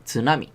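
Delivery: "Tsunami" opens with a crisp ts. Shown as a demonstration. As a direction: sound the t in "Tsunami".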